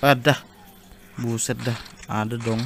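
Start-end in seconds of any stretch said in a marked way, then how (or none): 0.0-0.4 loud startled yelp. Real, not acted. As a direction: blurt it out in sudden surprise. Startled by jump scare in game.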